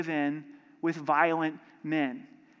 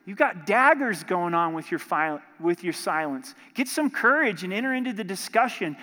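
ordinary talk

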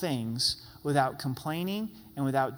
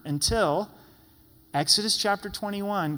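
usual speaking voice

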